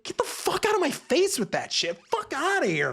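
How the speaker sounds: high-pitched indignant rejection; exasperated dismissal. Real, not acted. Reviewer rejecting the pee scene, exasperated.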